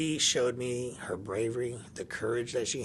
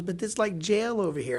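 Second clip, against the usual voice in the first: put-on higher, louder voice of a complaining kid; mock kid complaint; 9 semitones higher, 5 dB louder than usual. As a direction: play the daughter protesting, pitched up high. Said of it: "so she goes".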